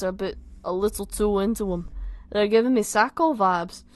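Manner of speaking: loud, amateur Scouse; Liverpool accent. Acted. Tom asking Simon to look, Liverpool accent. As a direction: lay on a put-on Liverpool accent. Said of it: "My accent's going everywhere".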